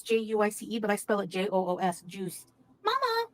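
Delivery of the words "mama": high call across the house. Real, not acted. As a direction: call out for Mama. Calling out to mother.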